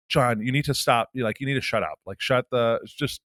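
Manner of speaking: low urgent hush to stop a friend. Retold or imitated. Telling a ranting friend to shut up in a low urgent hush. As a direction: hiss it under your breath, urgent.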